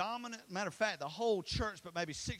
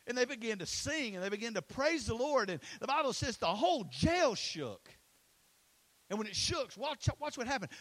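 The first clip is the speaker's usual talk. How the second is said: raised, driving preacher voice; impassioned preaching; 4 semitones higher, 4 dB louder than usual. Real, not acted. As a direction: preach it high and loud to the room. Preaches the jail story at full force.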